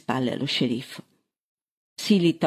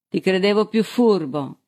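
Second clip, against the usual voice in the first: higher-pitched reproach; scornful exclamation; 5 semitones higher than usual. A narrator's reading. Sheriff standing over the man he just struck, exclaiming reproachfully. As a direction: exclaim it as a rebuke. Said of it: "esclamò Balsili".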